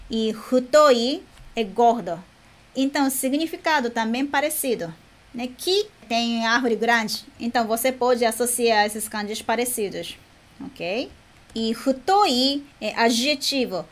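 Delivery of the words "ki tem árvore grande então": ordinary talk, nothing marked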